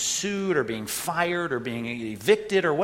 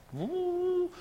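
long high-pitched hoot; high imitated "woo"; 3 dB quieter than usual. Preacher marking the famous cellist's surprise appearance, high drawn-out woo. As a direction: sail up to one sustained high note.

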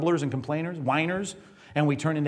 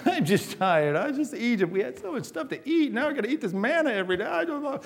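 high, whining grumble, rushed in the middle; whiny complainer; 7 semitones higher than usual. Retold or imitated. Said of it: "a lot of them were like".

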